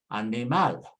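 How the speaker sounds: animal as ah-nee-MAL, stress on MAL; Spanish last-syllable stress. Shown as a demonstration. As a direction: say animal with Spanish vowels, landing on final MAL. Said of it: "stress on the last syllable".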